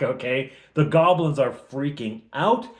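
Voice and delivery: ordinary talk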